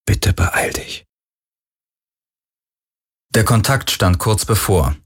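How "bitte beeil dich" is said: slow, whispered inner plea; urging under the breath; whispered pleading thought. A narrator's reading. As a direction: whisper it as an urgent silent wish.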